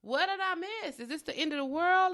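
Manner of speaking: high, rising disbelief at finding empty shelves; retold shock. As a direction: replay the moment of panic, half-mock. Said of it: "And I was like".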